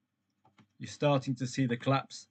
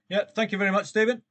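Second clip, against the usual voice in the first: bright thanks; 6 semitones higher, 3 dB louder, faster than usual. Real, not acted. Thanking a chat viewer.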